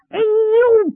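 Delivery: high, drawn-out "aiyo"; loud pained cry. Acted. Yu Hualong struck in the ribs, crying out in pain. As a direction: yelp from a blow to the ribs. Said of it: "于化龙大叫一声".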